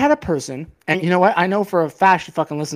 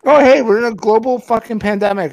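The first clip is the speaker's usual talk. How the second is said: louder, higher mock cheer; loud sarcastic outburst; 5 semitones higher, 6 dB louder than usual. Real, not acted. Mocking the ex during a pandemic, loud. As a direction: spell out the absurdity.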